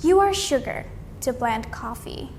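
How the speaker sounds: usual speaking voice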